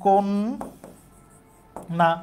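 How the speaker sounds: "কোন না" as "kon", long pause, "na"; correct split syllables. Shown as a demonstration. Teacher sounding out the word, kon and na far apart. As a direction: break "কোন না" widely after a held n. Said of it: "তাহলে উচ্চারণ হবে".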